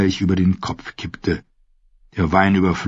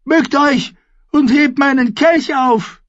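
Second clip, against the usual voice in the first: put-on much higher and louder, screeching young king; shouting boy king; 4 dB louder than usual. A narrator's reading. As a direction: yell it down at the dwarf. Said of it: "schrie Joffrey".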